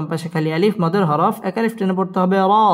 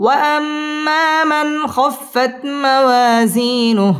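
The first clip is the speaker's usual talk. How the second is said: higher, slow chant opening on وأما; high recitation; 6 semitones higher, much slower than usual. Shown as a demonstration. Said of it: "wow ঠোঁট গোল করে উচ্চারণ করতে হবে". (উঁচু সুরে তিলাওয়াত)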